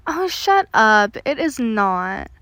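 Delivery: put-on slow, drawn-out teenage protest; teased protest. Son answering his father's teasing, in drawn-out protest.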